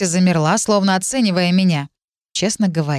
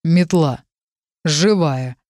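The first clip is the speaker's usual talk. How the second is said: low, squeezed-out dragon voice; stunned dragon voice; 3 semitones lower, much slower than usual. Acted. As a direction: force it out in shock, low. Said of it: "выдавило моё второе чуждое сознание". (ошарашенный голос дракона)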